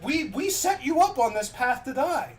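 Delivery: high, pleading voice; lightly acted desperate sorry; begging apology. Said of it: "they were begging on their knees".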